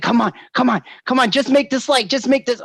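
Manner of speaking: high, urgent pushing; will the light to stay green; impatient driver. Retold or imitated. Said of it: "It was always like".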